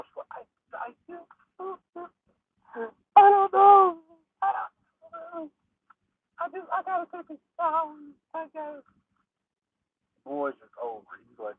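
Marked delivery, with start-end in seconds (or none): 0.3-8.8 very high falsetto, frantic and breaking; hammed-up high-pitched distress; shaken woman. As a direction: stammer in a high panicked girl voice. Traumatized woman questioned by others.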